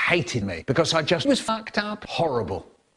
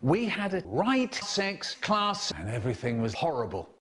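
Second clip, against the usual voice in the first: quiet; 9 dB quieter than usual. Acted. Sudden quiet dark turn.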